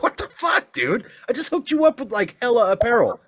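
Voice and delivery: high, incredulous mock outrage. Dad who bought Jason gear hears his son wants to be a zombie, mock outraged. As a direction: act betrayed over the costume.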